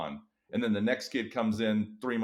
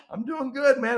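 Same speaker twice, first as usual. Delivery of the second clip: high, louder, upbeat reply; perking up; 6 dB louder than usual. Retold or imitated. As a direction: sound like spring is here. April, spirits rising.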